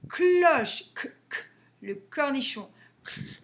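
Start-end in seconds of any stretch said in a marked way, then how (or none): 0.1-0.9 "cloche" said much higher and louder; called out cloche. Shown as a demonstration. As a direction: call out "cloche". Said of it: "on entend le".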